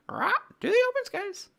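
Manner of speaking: loud, high parrot squawk; bird voice. Acted. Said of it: "my bird voice".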